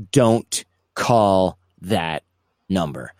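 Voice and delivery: grave, measured retold phone warning; slow, deliberate warning. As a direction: warn slowly, word by word. Retold phone warning, spaced word by word.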